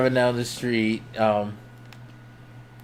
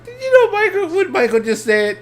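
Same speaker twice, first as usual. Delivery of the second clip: much higher teasing mom voice; mocking mom. Mom telling relatives what Michael said, much higher teasing. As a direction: imitate mom gleefully telling the family. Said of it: "She's like".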